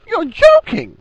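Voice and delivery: shrill choked-out squeak; high-pitched girlish yelp. A narrator's reading. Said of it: "choke out, in a high, girlish voice".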